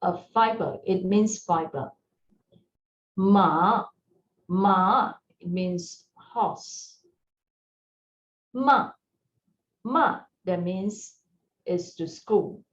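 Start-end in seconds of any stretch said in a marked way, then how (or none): 3.2-5.1 ma dipping down then up; falling-rising tone ma. Shown as a demonstration. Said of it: "falling rising tone". 8.5-8.9 ma dropping sharply; falling tone ma. Shown as a demonstration. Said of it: "the tone is falling down".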